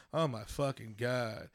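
low, slow, quiet dismay; under-the-breath mock dismay; appalled disbelief. Imagined Russian reacting to bad accent.

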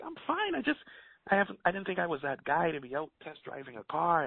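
flustered self-quote. Retold or imitated. Retold flustered reply.

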